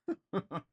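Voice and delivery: a high burst of laughter; bright real laugh. Real, not acted. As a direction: laugh at your own joke. Laughing at own bit.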